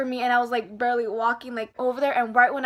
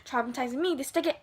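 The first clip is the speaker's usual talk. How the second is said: ordinary talk, nothing marked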